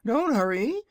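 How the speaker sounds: high, proud little dragon voice; a boastful baby dragon, pitched high; young dragonette. Acted. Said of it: "called one of the dragonettes".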